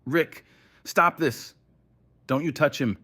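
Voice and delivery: loud, high, desperate shouting; furious plea. A narrator's reading. Nate fights his captors to save Will, furious. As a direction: shout to stop them, furious. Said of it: "his fury unrestrained".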